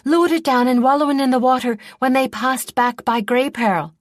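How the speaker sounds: loud and high, bursting with glee; can't hold the good news in; gleeful rush. A narrator's reading. Boy gleefully spills the news to the princes, loud and excited.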